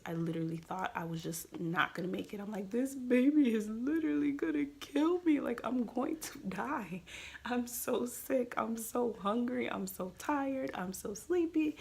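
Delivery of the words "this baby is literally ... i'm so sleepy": acted-out exhausted despair, pitched up and stretched; dramatic whining. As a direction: whine it out like a hammed-up complaint. Retelling own despairing pregnancy thoughts.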